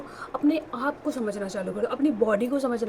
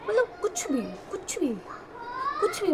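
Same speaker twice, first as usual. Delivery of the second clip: scornful disbelief; 10 semitones higher, 4 dB quieter than usual. Real, not acted. Scoffs at absurd pregnancy advice.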